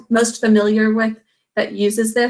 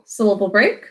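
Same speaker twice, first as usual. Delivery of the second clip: ordinary talk, nothing marked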